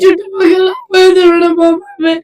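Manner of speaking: sobbing. Real, not acted. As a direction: wail it out through tears. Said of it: "వద్దు, వద్దు, ఏడవ". (గట్టిగా ఏడుస్తూ)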